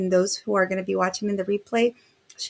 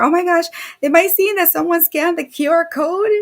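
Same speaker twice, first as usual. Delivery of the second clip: much higher and louder, thrilled; excited surprise; 9 semitones higher, 8 dB louder than usual. Real, not acted. Host sees someone scanned the code, excited. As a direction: gasp it out, delighted by the news.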